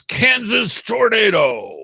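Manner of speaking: high, slow, drawn-out billboard voice; big-lettered headline. Retold or imitated. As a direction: boom it out like giant printed words. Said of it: "said in big words".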